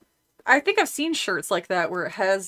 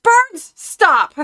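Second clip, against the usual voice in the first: much higher, louder yell; yell at birds; 12 semitones higher, 10 dB louder than usual. Shown as a demonstration. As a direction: shout the birds off. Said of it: "I will yell".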